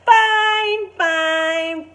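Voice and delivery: high, loud cheering shout of fine; joyful shouted chant. Acted. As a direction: cheer it out like a whole school. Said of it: "fine teachers shout".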